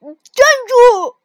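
a sharp yelled command; shouted stop. Acted. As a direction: shout to halt the fleeing storks. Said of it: "跳跳喊道".

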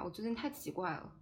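usual speaking voice

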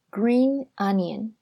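green onion slow, with green stressed and a rise at the end; teacher's model word. Shown as a demonstration. Teacher teaching the word for 葱, slow and careful. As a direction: stretch out green onion so students hear each sound. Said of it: "葱怎么说呢？叫做".

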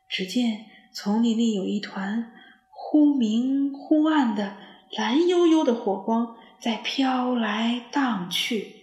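ordinary delivery, nothing marked

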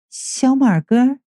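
slow, high, flirty woman's voice; coy woman's call. A narrator's reading. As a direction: linger on the name sweetly. Sanniang calls him again. (娇媚地慢慢唤他)

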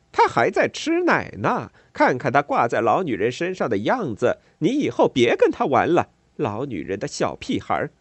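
loud, jeering words meant to be overheard; loud mocking taunt. A narrator's reading. Neighbour mocking the boy loudly. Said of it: "他放大了声音，让我听清楚".

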